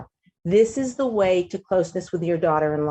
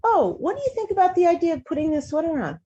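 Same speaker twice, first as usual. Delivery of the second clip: gentle suggestion; 8 semitones higher than usual. Shown as a demonstration. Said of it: "Instead of saying it that way".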